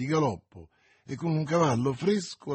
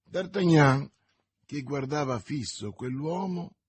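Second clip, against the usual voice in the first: plain reading voice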